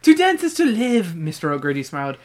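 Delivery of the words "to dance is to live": high, loud Irish lilt; put-on Irish accent. Mister O'Grady defending dance to Eddie, Irish accent. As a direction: proclaim it in stage Irish. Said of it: "I like your Irish accent there".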